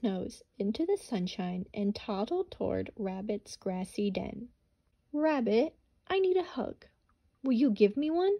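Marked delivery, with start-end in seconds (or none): 5.1-8.4 high, pleading little-animal voice; higher and louder, hopeful hedgehog asking Rabbit for a hug. Acted. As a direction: ask like a small lonely hedgehog.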